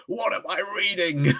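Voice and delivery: high-pitched despairing cry, hammed up; mock dying wail. Streamer imagining dying at the end of the stream, mock wail.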